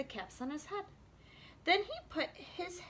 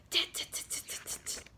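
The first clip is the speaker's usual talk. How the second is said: crisp, unvoiced tongue clicks; monkey tutting; 3 dB quieter than usual. A narrator's reading. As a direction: mimic scolding monkeys with quick tuts. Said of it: "monkeys only stomped their feet back".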